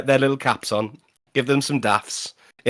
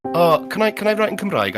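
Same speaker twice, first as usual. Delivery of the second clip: pupil asking; 8 semitones higher than usual. Retold or imitated. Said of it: "one of them went".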